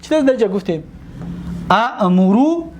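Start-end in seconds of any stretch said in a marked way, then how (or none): 1.7-2.7 slow teaching demo of اامرو; slow drawn-out original form. Shown as a demonstration. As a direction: stretch اامرو slowly, both hamzas heard.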